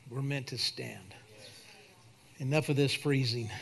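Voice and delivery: low, soft pastoral invitation; altar call. Real, not acted. Pastor inviting anybody to the altar before praying, hushed altar call. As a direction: bring it right down, gently inviting.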